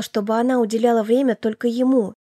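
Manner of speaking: ordinary delivery, nothing marked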